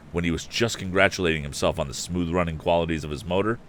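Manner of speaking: ordinary delivery, nothing marked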